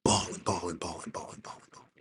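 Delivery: real grief, the words dying away into breath; fading from a choked whisper to almost nothing. Real, not acted. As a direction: voice breaking down to a whisper. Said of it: "and I just start".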